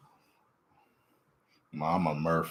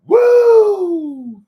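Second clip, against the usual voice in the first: loud high whoop; high cheering woo; 11 dB louder than usual. Real, not acted. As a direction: whoop with delight.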